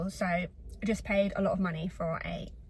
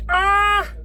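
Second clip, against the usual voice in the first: a sudden high, loud yelp of dismay; short loud "ah!" of shock; startled yelp; 10 semitones higher, 12 dB louder than usual. Real, not acted. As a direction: yelp out loud as the penny drops. Sudden realisation alone in the car.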